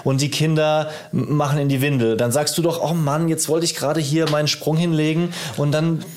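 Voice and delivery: normal talking voice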